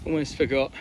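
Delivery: ordinary delivery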